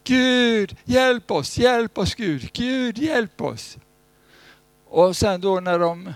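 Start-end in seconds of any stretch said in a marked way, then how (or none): 0.0-3.8 loud high plea for help, slowing at the end; mock desperate crowd crying out; pleading cry. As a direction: beg God to save you from the storm. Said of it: "människor så här".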